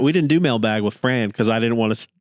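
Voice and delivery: normal talking voice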